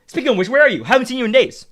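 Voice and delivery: ordinary talk, nothing marked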